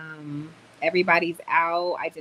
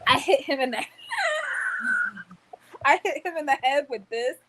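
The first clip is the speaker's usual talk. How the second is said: telling through laughter, higher; giggling while showing the weight; laughing; 10 semitones higher than usual. Real, not acted. Showing viewers the weight, laughing higher. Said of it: "Let me show y'all".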